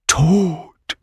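mock voice from the grave; sepulchral voice. A narrator's reading. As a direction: intone it like a ghost. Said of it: "rief Patrick mit Grabesstimme".

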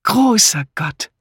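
hoher, gedehnter Ausruf; erstaunter Ausruf. A narrator's reading. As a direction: rufe es verblüfft und langgezogen. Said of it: "rief Misses Harmond". (verblüfft ausrufend)

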